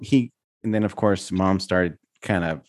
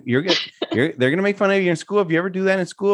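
higher, hurried scolding; mild mock scolding, pitched up; retold parental warning; 9 semitones higher, faster than usual. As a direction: warn a child about being teased. Mom warning son, retold.

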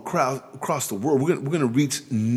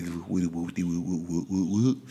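soft, low, playful cooing sounds; mock lovey-dovey cooing; 7 semitones lower, 5 dB quieter than usual. Husband teasing about his marriage, mock lovey-dovey cooing. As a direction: coo like a lovestruck couple. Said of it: "lovey-dovey".

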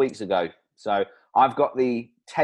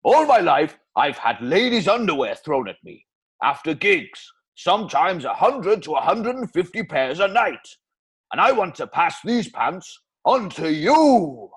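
lightly Welsh celebrity ad read; easy Welsh crooner pitch; Welsh accent. Acted. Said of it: "Bloody Welsh accent again".

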